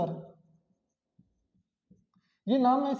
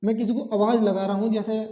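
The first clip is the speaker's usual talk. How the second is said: usual speaking voice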